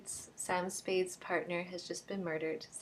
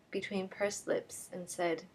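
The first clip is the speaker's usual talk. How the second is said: plain reading voice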